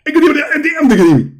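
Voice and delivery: high, slow, laughing mock gibberish; laughing mockery. Mocking the caller, slow and high through laughter. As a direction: drag out the nonsense while laughing. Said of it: "I think you are cursing me now".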